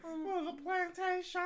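high, slow, sing-song bad southern accent; hammed-up southern drawl, stretched right out; Oscar. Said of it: "trying to speak in the s".

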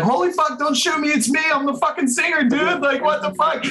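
very high-pitched panicked begging, acted out; hammed-up high frantic plea; mock crying plea. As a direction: imitate a terrified singer pleading, way up high. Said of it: "like, crying".

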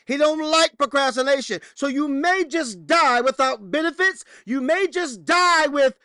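high, loud preacher's call; preacher scolds the congregation about procrastination; full-force preaching. Acted. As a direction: hammer the point home.

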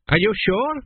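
put-on mildly bewildered old man checking; befuddled doubt. A narrator's reading. King doubting his son's birthday, puzzled.

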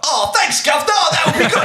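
high, loud hammed-up gratitude; mock-thrilled acceptance. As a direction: burst out as an overjoyed actor, playing it up. Acting actor thrilled to get part.

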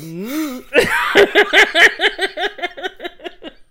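loud high laugh; loud laughter. Real, not acted. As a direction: howl with laughter. Cracking up.

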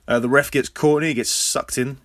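usual speaking voice

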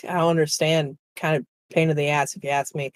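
ordinary talk

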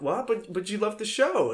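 high-pitched, stammered protest; mock disbelief. Imagined applicant to interviewer after rejection, mock disbelief. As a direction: protest in acted bafflement, voice up.

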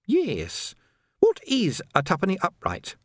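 Sandra asking sweetly; brightly. A narrator's reading. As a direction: play dumb with a cheerful lilt. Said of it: "said Sandra brightly".

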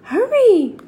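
high, loud hurrying call. Acted. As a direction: urge him on from afar. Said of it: "called Annie".

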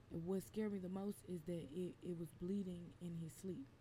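normal talking voice

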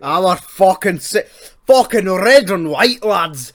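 loud, slow put-on Scottish-ish accent; botched Scottish accent. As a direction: bellow it in a badly faked Scottish accent. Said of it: "I don't know what I'm talking in Scottish accent".

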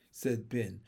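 plain reading voice

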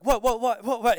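acted jolt out of sleep; startled awake. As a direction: blurt it as if shaken from sleep. Retold being shaken awake.

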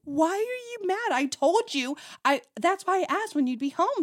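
high-pitched imitation of a baffled partner; exaggerated confused self-defense; mock confusion. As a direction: act out bewildered protest, voice jumping up. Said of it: "so confused".

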